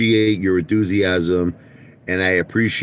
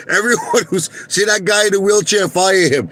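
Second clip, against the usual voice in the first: much higher, louder mock boss barking orders; hammed-up executive firing people; mock CEO orders; 11 semitones higher, 3 dB louder than usual. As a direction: bark it like a heartless CEO. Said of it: "He said".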